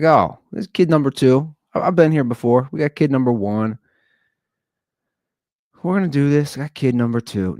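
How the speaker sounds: cocky confidence. Retold or imitated. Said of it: "was like".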